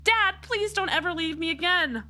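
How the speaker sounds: put-on high, begging girl voice; pleading daughter. Daughter begging her father never to leave, high and earnest. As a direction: plead with him not to go.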